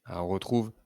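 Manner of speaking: normal talking voice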